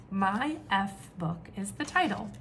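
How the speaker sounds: ordinary delivery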